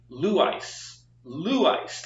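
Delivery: LOU-Ice joined as one word, first syllable stressed. Shown as a demonstration. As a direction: run "Lou Ice" together, hitting "Lou". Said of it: "say it all together".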